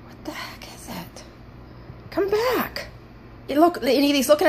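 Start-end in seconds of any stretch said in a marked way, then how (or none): 0.1-1.2 whisper. Real, not acted. Hushed at the floating light.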